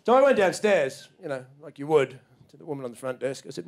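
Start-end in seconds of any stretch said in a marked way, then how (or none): none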